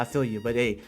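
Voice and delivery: normal talking voice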